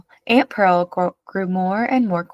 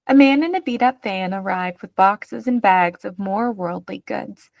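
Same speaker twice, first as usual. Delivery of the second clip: plain reading voice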